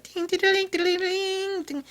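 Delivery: very high ding ding chime; mock chime. Vlogger imitating cheesy Disney chime music in a very high voice. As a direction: sing the cheesy jingle.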